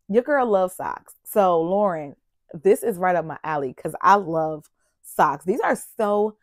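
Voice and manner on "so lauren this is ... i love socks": ordinary talk, nothing marked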